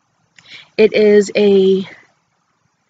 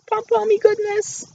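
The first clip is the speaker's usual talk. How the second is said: delighted squeal; 3 dB quieter than usual. Real, not acted. Gushing over her new hat.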